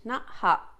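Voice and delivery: interrupted by a hiccup; hiccuping mid-phrase. Real, not acted. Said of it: "I got the hiccups again".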